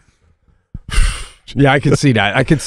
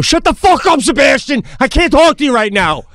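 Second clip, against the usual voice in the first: a pilot losing it mid-crash; hammed-up stressed pilot shout; mock panicked yell; 9 semitones higher than usual. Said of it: "Would they go".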